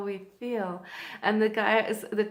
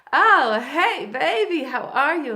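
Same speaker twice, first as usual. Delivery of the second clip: loud, swooping come-on, pitched up; flirty admirer; 5 semitones higher, 12 dB louder than usual. Retold or imitated. Miami man greets arriving woman, exuberantly flirty. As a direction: imitate a man delightedly greeting a beauty.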